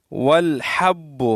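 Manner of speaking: والحب slowly, with ح squeezed from the throat; correct throaty ha. Shown as a demonstration. Teacher modelling the right reading, slow throaty ha. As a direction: draw out والحب, throaty h and doubled b. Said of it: "اس طرح پڑھیں گے".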